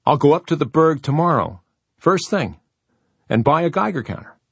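excitement pulsing under a reasonable tone; suppressed excitement. A narrator's reading. Kurt offering to buy a Geiger counter, suppressed excitement. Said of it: "the pulse of excitement in his voice".

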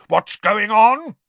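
loud, slow, high furious uncle; angry demand. A narrator's reading. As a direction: bark the demand slowly.

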